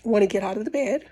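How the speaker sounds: blocked nose, nasal-sounding talk; stuffy nose. Real, not acted. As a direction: speak through a stuffy nose. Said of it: "You could probably hear it".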